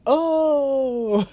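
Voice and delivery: high, amused exclamation; laughing commentator. Retold or imitated. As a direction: imitate a tickled color commentator. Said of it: "He kinda laughs".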